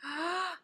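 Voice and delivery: sharp, high little gasp; quick awed intake of breath; gasp of delight. Real, not acted. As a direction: catch your breath at how pretty it is. Awed by a swatched shade.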